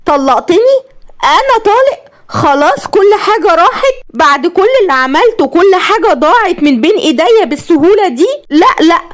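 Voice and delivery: very high, crying disbelief; hysterical. Acted. Divorced wife breaks down in hysterical disbelief. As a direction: come apart in hysterics. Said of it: "وقالت بهستيريا".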